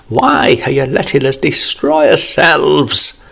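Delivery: wailing plea; desperate wailing prayer. Acted. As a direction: cry out to God in despair. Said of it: "he wailed".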